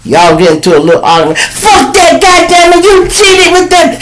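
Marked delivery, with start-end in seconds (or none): 1.4-4.0 put-on angry girlfriend. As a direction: screech at him for cheating. Acting out angry woman in argument.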